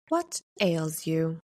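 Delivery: slow, even monotone question; simple monotone. A narrator's reading. Shopkeeper asks the teenager what ails her, simple monotone. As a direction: ask it slowly with no lift at all. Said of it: "in a simple, monotone voice".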